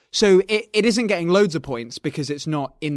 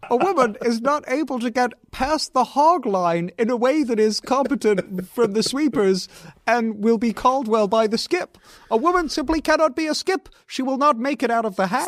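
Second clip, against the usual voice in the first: higher, puppet-like lecturing voice; Kermit-style Peterson; 6 semitones higher than usual. Pretend. Mocking Peterson's lecture in a Kermit voice. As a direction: pronounce it like a self-serious frog. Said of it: "Kermit the Frog more polite".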